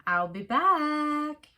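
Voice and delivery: mock tough-guy. A narrator's reading. Terminator line.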